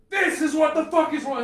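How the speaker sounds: loud, high angry yell; yelling at friends who thought he was faking; furious shout. Retold or imitated. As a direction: shout it at them to prove it. Showing the swollen knee.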